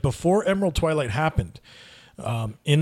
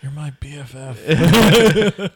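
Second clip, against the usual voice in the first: playful slow mock BFF; 2 semitones higher, 8 dB louder, much slower than usual. Teasing mock friendship.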